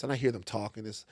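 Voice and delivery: ordinary talk, nothing marked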